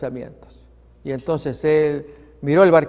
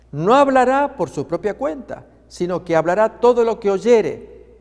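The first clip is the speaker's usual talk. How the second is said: solemn Bible reading; 2 semitones higher than usual. Acted. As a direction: proclaim the verses to the congregation. Reads Scripture passage aloud.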